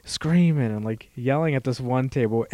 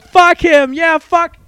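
very high, loud rowdy chant; retold crowd yell; 11 dB louder, much slower than usual. Retelling the bar table screaming in high loud chants.

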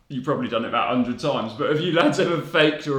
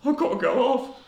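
the second go off pitched much higher, pleading; mock pleading; 12 semitones higher than usual. Boy begs the physio to take him off, mock plea. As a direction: beg to get off, voice jumping up. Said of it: "I was like".

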